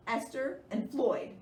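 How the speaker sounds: usual speaking voice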